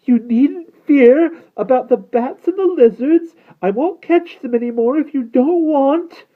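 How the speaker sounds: high, loud, strained coaxing; high vampire voice, sweetness wearing thin; honey turning to frustration. A narrator's reading. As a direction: coax loudly with frustration breaking through. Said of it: "frustration beginning to override the honey in his tone".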